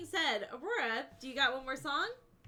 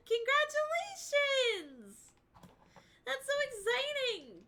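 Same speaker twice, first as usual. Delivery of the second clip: stretched-out, high, delighted congratulations; excited congratulations; 8 semitones higher, 5 dB louder than usual. Real, not acted. Streamer cheering a chat friend's new kitten, delighted and drawn out. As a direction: cheer it slowly and brightly, really happy for them.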